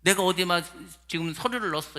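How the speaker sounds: ordinary talk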